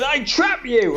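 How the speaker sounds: spring the trap with glee; imitating a gleeful TV host; teasing interviewer. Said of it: "He goes".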